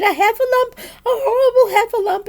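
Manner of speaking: high, loud terrified wail; frightened little creature shrieking; Piglet crying out. A narrator's reading. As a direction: cry the alarm in panic. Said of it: "cried Piglet".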